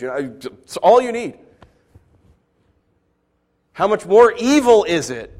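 ordinary talk

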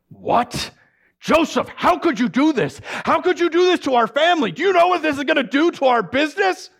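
father confronts his son about the family's shame, angry. Acted. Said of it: "My father was angry".

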